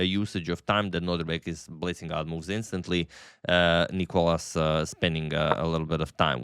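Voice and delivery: ordinary talk, nothing marked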